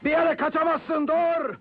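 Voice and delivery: shouted command. Acted. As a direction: call out sharply to stop a runaway. Chasing someone who runs away. (yüksek sesle dur diye bağırarak)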